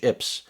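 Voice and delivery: ips spoken as a single syllable, like "lips" without the L; acronym as word. Shown as a demonstration. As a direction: run ips together as one word. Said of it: "Nobody says".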